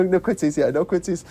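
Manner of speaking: normal talking voice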